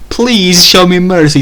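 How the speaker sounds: high, loud begging; mock pleading. Kaiju pirate begs Zuimama for mercy, mock pleading. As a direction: beg for mercy in a hammed-up wail. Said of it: "begged and pleaded for mercy".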